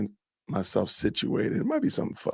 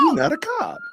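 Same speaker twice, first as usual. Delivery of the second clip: mocking high voice; 5 dB louder than usual. Streamer mimics the woman.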